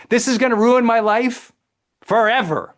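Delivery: hammed-up doom over a small problem; dramatic catastrophizing. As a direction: wail it like the end of the world. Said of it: "we use dramatic language".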